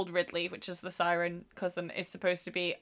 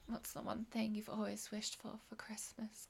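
seductive whispered purr; sexy whisper; 16 dB quieter than usual. Retold or imitated. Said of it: "sexy voice at him".

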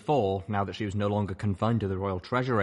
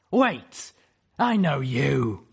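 sharp high griffin challenge; stern griffin voice; 5 semitones higher, 7 dB louder, much slower than usual. Acted. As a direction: call out in a hard female voice.

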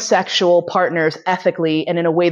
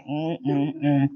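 soft, low muffled "mm" hums, holding back; hesitant humming; 4 semitones lower, 7 dB quieter than usual. Retold or imitated. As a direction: hum nervously, too shy to say it. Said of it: "I was just like".

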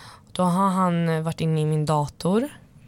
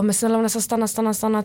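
mild mock begging, pitched up; imitated pleading; 4 semitones higher, faster than usual. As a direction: plead in quick repeats, lightly acted. Imitates ex begging her to stay. (lätt härmat bedjande)

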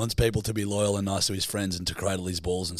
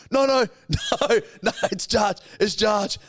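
high, louder frantic reassurance through a laugh; panicked backpedal; 11 semitones higher, 5 dB louder than usual. Retold or imitated. Prankster tells a crying friend it's a joke, flustered and high. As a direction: scramble to undo the prank. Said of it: "I'm like".

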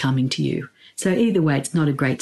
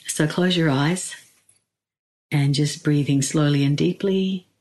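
guided meditation. Acted. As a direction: ease them in with long pauses.

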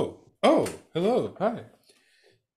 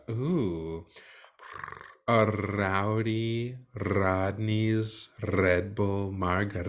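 drawn-out, slow, lower order; slow savoring; 4 semitones lower, slower than usual. Shown as a demonstration. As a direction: savor each word slowly.

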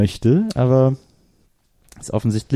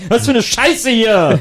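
higher, louder angry yell; choleric outburst; 9 semitones higher, 3 dB louder, slower than usual. Acted. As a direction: blow up at the station. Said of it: "als super Choleriker bekannt werden".

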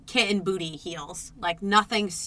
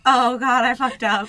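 mildly high, louder mock dismay; raised nervous voice; 3 semitones higher, 5 dB louder than usual. Realizing she botched the greeting, voice a bit raised. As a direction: let slight panic lift the pitch. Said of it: "Your voice went up".

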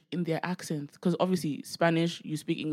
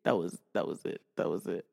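low contented sigh; 10 semitones lower, 6 dB quieter than usual. Real, not acted. Savoring the wind.

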